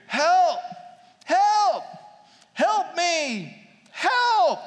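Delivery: high, loud, drawn-out mock yell; imitated cry for help. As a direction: call out like someone stranded, acted out. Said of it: "And then I hear".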